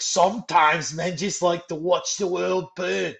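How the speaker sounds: a clumsy attempt at Caine's Cockney; terrible Michael Caine impression. Friend mimics Alfred's line from The Dark Knight in a bad Cockney accent. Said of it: "that's a terrible impression".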